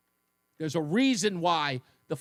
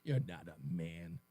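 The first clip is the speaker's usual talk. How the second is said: mock disgusted; 7 semitones lower, 13 dB quieter than usual. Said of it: "Just going".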